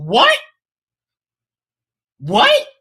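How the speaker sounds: high, loud incredulous yelps; shocked what. Real, not acted. Reacting to his own claim, high shocked yelps. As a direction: cry out in disbelief. Disbelieving cries.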